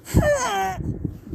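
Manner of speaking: squealing high laugh; a delighted squeal of laughter; high giggle. Acted. Both reacting to the cute moment, squealing giggle.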